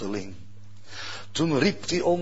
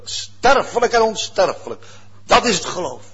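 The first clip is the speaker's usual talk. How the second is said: louder, higher preaching; 6 semitones higher, 6 dB louder than usual. Acted. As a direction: declaim the contrasts. Preaching at full force. (hoogdravend preken)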